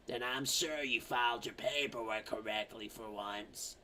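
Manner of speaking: a touch of the slug lady, quietly. Retold or imitated. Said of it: "slug lady's like".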